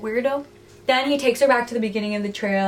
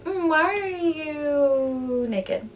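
long, drawn-out flirty question; hammed-up coy curiosity; mock intrigued; 5 semitones higher, much slower than usual. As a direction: start high and slide slowly down, playfully interested. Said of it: "kinda into it".

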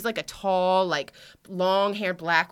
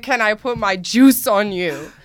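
slow, louder mock seductive Frenchman; mock French lover line; 3 semitones higher, 10 dB louder, slower than usual. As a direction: drawl it like a smitten Frenchman. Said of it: "this dude just said".

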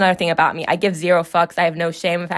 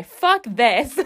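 defiant swear; 4 semitones higher than usual. Shown as a demonstration. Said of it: "Oh, sorry".

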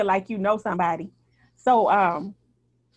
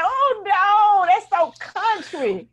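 high, loud mock-horrified cry; loud dismay; 8 semitones higher, 5 dB louder, much slower than usual. Reacting to an all-red outfit, loud hammed-up dismay. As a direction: cry out high, stretching the last words.

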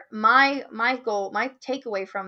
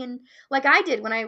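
normal talking voice

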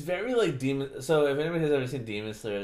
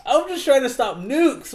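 mock whine; 13 semitones higher, 7 dB louder than usual. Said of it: "putty people".

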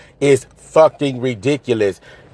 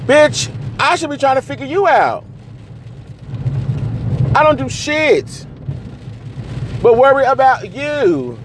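much higher, strained voice, slowing down; strained exasperated plea. Real, not acted. Venting at her partner, pitched up high and strained. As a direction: squeeze it out high, near breaking.

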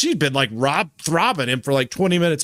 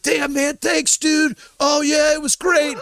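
grateful buddy; 10 semitones higher, slower than usual. Retold or imitated. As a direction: thank him like a thrilled friend. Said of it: "He's like".

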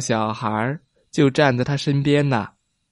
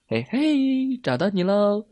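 playful call; 8 semitones higher, 4 dB quieter than usual. A narrator's reading. Hide and seek game.

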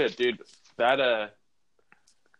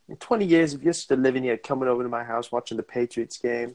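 put-on Boston accent; 2 semitones higher, 3 dB louder than usual. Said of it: "It's like".

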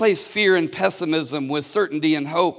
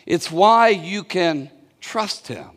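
ordinary talk, nothing marked

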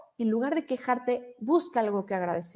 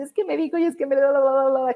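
fast, higher, whiny venting; whiny mock complaint; 3 semitones higher, 4 dB louder, faster than usual. Coach mimics venting to a friend, fast whiny complaining. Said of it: "así de".

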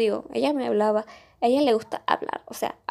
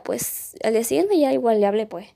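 ordinary talk

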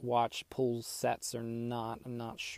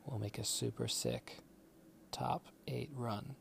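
hushed; 9 dB quieter than usual. Real, not acted. Hushed teaser sign-off.

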